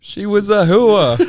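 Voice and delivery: put-on high, loud, slow New Jersey accent; laughing through a New Jersey accent. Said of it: "But in, like, a Jersey accent".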